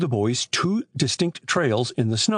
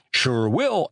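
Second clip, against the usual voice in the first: usual speaking voice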